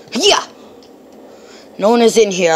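ordinary talk

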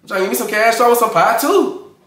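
rising excitement over the beat; hyped up. Real, not acted. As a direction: cheer the verse on. Hyped by the verse.

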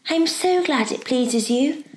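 put-on high, unhurried girlish voice; eager helpful girl. Girl to the professor, glad and eager. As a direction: voice a polite, eager child, pitched high. Diana's voice in the story.

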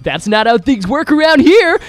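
high, slow mock cocky line; Sonic voice. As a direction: drawl it like a smug cartoon hero. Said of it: "And Sonic's like".

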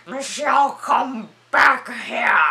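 put-on creepy British-accented call, slow and loud; hammed-up British villain, stretching the words. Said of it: "I gave him a British accent".

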